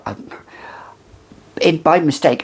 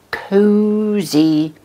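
cozy drawn out, much higher and cooing; cooed cozy; 8 semitones higher, 3 dB louder than usual. Real, not acted. Vlogger swooning over the scent, cozy cooed up high. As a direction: coo the word cozy up high like to a cat.

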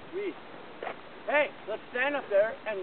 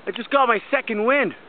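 excited; 7 dB louder than usual. Real, not acted. Pumped up hiking friend.

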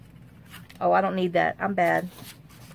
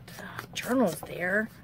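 whisper; 6 dB quieter, slower than usual. Real, not acted. Musing to herself while crafting.

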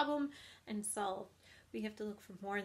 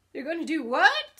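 loud, high shriek of shock; screamed disbelief; 7 semitones higher, 16 dB louder than usual. A narrator's reading. Pig learns of the plan to kill him, screaming. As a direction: scream it, pitched way up. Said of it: "screamed Wilbur".

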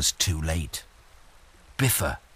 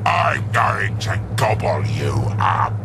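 troll roar. Acted. Troll threatens.

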